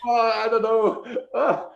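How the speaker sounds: laughing while talking; high giggly words. Real, not acted. Laughing at creepy sales voice.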